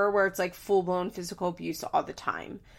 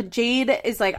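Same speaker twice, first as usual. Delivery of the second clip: ordinary talk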